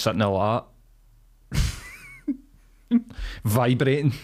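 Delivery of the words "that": long high drawn-out strangled squeak; a frozen, high-pitched whine of nothing; mimed blank stare noise. Pretend. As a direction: squeak like your brain has gone empty. Blank stare sound, sitting there.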